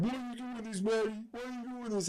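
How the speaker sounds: high, soft imitation of a victim; hammed-up helpless protest; mock pleading. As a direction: plead in a small, high mocking voice. Victim pleads after being knocked out.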